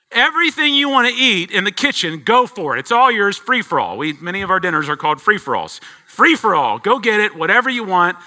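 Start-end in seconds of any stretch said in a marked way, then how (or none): 0.1-4.0 a hint of a cheerful dad, high and loud; dad to daughters. Father telling his five daughters to help themselves, cheerful. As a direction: offer the whole kitchen freely. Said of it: "me saying to my five daughters".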